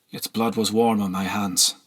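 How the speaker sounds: ordinary delivery, nothing marked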